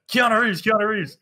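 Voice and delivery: high-pitched mock excitement; excited guessing, imitated. Everyone guesses the charades card, imitated excited calls. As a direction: call out the guess like an eager crowd.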